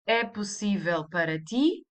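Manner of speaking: "É possível para ti" rising on the final "ti"; question intonation. Shown as a demonstration. Teacher asking an informal subject, rising tune on "ti". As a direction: lift "ti" at the end to ask. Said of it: "You just raise the intonation".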